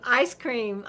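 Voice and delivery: ordinary talk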